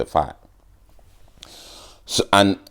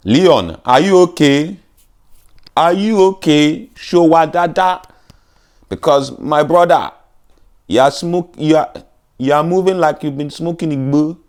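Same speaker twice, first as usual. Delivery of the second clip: mock Jamaican accent; 8 semitones higher than usual. Mocking Leon Edwards in a Jamaican accent. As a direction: tease the fighter in a Jamaican accent. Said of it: "he fights like a Jamaican boy".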